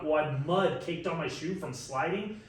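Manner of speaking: usual speaking voice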